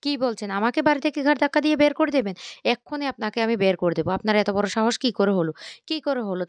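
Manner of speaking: loud, indignant storyteller's outburst; angry. A narrator's reading. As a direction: act out outraged disbelief, loudly. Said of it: "রেগে গিয়ে বলতে লাগলো".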